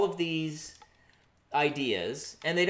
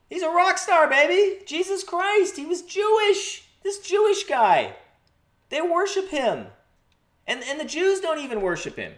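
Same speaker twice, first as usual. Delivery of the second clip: excited; 13 semitones higher, 6 dB louder than usual. Acted. Excited riff on Jesus rock star.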